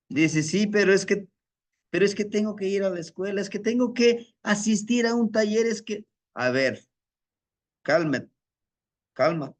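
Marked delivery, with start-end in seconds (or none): 0.4-6.1 put-on rushed, higher whiny excuses from a busy young man; hurried excuses. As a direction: rattle off excuses fast and higher. Joven apurado da excusas. (joven apurado dando excusas rápidas)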